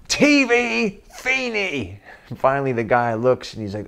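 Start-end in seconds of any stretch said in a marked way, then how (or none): 0.1-2.0 slow, high, drawn-out repeat of "T V Feeney"; puzzled echo. Retold or imitated. French guy repeating the father's odd demand, slow and high. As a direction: echo the strange words back slowly, puzzled.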